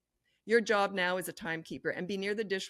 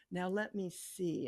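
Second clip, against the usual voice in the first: very slow, musing as if never seen it; slow, feigned surprise at the board; slow puzzled look; 5 dB quieter, much slower than usual. Shown as a demonstration. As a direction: pretend it's new to you. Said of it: "you've never seen it before".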